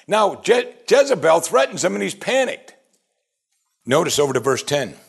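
normal talking voice

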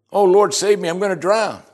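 high, loud mock cry for help; hammed-up panicked plea, pitched high. Peter sinking beside Jesus, acted panic. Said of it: "and he's panicking".

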